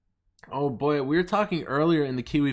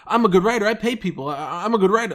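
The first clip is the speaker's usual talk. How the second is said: higher, louder mock braggart; mocking boasting; 8 semitones higher, 7 dB louder, faster than usual. Mocking a writer who constantly tweets out reassurance, mocking boasting. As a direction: mimic an insecure boaster, pitched up and pushed loud.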